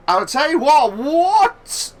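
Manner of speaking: loud, high, astonished yelling; yelling at a horse race. Real, not acted. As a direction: shout in disbelief at the move. Overtake climax in commentary.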